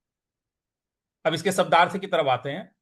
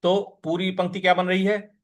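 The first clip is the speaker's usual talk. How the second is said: usual speaking voice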